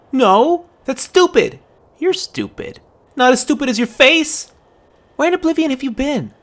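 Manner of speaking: put-on loud high childish squabble; bickering shout. Girl and boy quarrel, loud high squabble. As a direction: trade loud high insults.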